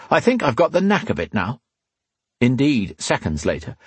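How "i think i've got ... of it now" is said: pleased with himself, pitch lifted; satisfied. A narrator's reading. Man who has just picked the manacles, satisfied. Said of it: "a satisfied tone in his voice".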